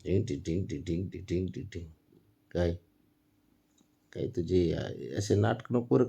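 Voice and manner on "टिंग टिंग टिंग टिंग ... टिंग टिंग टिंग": soft, fast ting-ting chant; playful ting chant. Real, not acted. Parent chants softly to amuse the baby.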